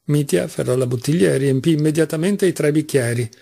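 ordinary delivery, nothing marked

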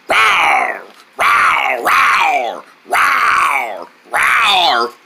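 repeated falling "rawr"; pretend dinosaur roar. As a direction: roar playfully like a little dinosaur. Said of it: "I'm roaring".

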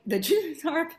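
high, loud, rising disbelief; incredulous echo. Retold or imitated. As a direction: imitate an astonished listener questioning it. He echoes her in disbelief.

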